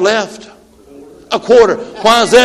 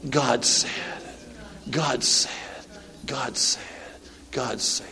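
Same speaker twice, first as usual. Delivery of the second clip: much softer and lower, repeated; hushed refrain; 9 semitones lower, 16 dB quieter than usual. Real, not acted. Preacher quietly repeating the refrain to the congregation. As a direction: let it sink in, softly.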